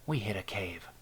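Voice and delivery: hushed whisper of unease; a tense whisper to her partner. Acted. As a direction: breathe it out without voice. Down in a dark tunnel.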